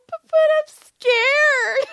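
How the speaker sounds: high, loud, slow whiny fear; hammed-up whimper to the man's blunt advice; mock scared whine. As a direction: play the frightened little kid. Repeating her fearful answer.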